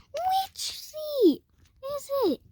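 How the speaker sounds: very high, squealed question; mock yell. Child yells at mom about spider, high mock yell. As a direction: imitate a panicked kid in a high squeal. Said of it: "And I yelled at her".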